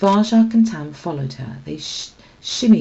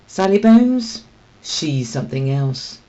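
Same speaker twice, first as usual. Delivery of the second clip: ominous warning; 5 dB louder than usual. Acted. Holly warns of a feared boss.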